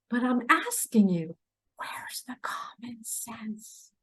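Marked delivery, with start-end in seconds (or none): 1.8-3.9 whisper. Real, not acted. Asking viewers, then repeats louder.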